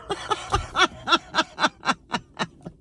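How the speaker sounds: long high real laugh. Real, not acted. Wife laughing at the honeymoon idea, real laugh. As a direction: burst out laughing.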